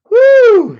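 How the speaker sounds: high, loud whoop of delight. Real, not acted. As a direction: let out a big cheer. Whooping after the story.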